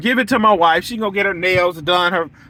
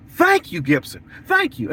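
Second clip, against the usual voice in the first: much higher, sing-song "Thank you"; mock grateful thanks; 6 semitones higher than usual. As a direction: chirp the thanks like a delighted customer. Mock client thanking him.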